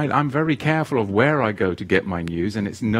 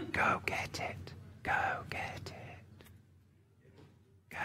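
속삭이듯 소름 돋는 목소리; creepy whisper; 18 dB quieter than usual. Pretend. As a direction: breathe it out like a lurking tempter. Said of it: "I could do a creepy whisper voice".

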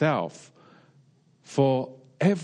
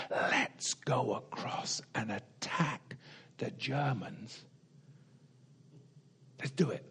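hushed, slow conspiratorial whisper; secret whispered order; 11 dB quieter than usual. Retold or imitated. Preacher voicing Washington's order to his men, whispered. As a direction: breathe it like a secret night plan.

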